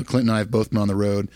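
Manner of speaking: ordinary talk, nothing marked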